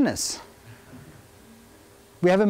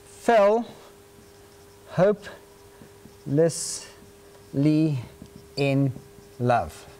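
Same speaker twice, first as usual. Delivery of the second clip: slow, sinking to a hush; dramatic slow; 6 semitones lower, much slower than usual. Shown as a demonstration. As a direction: let hopelessly in love fall away. Dramatic slow delivery of premise.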